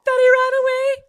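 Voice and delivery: high, loud tipsy shriek; shrieking confession. Retold or imitated. Sister shrieking the secret at pre-drinks. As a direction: blurt it out in a drunken squeal.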